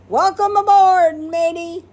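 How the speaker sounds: loud, high pirate greeting; yelled. A narrator's reading. The boy welcomes the cat aboard, loud and high. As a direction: call out like a pirate captain. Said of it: "Nick yelled".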